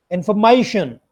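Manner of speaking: "Information" as in-fuh-MAY-shun; correct pronunciation of information. Shown as a demonstration. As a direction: schwa in the middle of "Information", stress MAY. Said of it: "the same way as we have pronounced".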